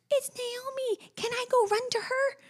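put-on high, slow, thrilled child voice; excited little girl. Mother imitating daughter's excited squeal at her friend. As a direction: voice a toddler spotting her best friend.

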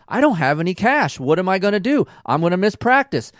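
hammed-up, high-pitched exasperated protest; imitated exasperated rant. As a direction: act out a wife spiking out, without malice. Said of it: "she will spike out, and not maliciously".

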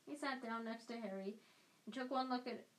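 ordinary delivery, nothing marked

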